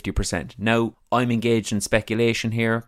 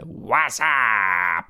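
imitating voicemail greeting. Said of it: "Robbie Savage's voicemail was".

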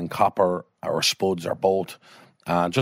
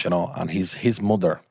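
usual speaking voice

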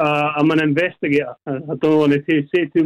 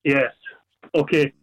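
ordinary talk, nothing marked

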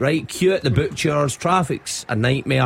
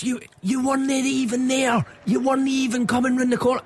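angry stranger; 12 semitones higher than usual. Retold or imitated. Retelling a drunk man blaming him, high mock indignation. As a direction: play the wronged drunk man, pitched way up. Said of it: "He says".

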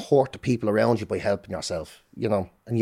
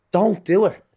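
1 urgent plea; 8 semitones higher, 5 dB louder, slower than usual. Real, not acted.

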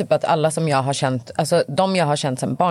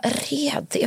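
high, softer, puzzled echo; questioning mum; 10 semitones higher, 5 dB quieter than usual. Acted. Mum questions daughter's borrowed dialect word, puzzled imitation. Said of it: "Hon bara".

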